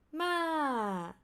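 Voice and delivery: "ma" from high sliding down; falling tone. Shown as a demonstration. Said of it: "these are the five Thai tones".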